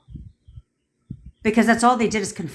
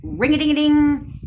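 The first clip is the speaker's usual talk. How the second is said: higher sing-song phone ringing; mock phone ring; 8 semitones higher than usual. Coach acts out a client's phone call, mock ringing. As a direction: imitate a phone ringing in a high voice. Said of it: "do you want him to".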